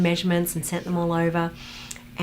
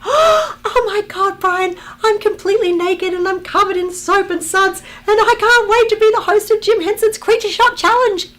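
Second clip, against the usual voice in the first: very high, loud, hammed-up squeal; ecstatic shrieking; 10 dB louder than usual. Actress tells producer she can't wait to host, ecstatic shrieking. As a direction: shriek it all out at the top of the voice. Acted reaction to getting the job.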